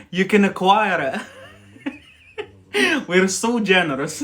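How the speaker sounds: loud, high, laughing. Real, not acted. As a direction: crack up as you boast. Laughing tease.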